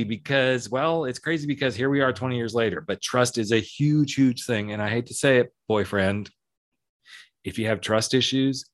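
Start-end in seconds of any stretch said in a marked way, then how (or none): none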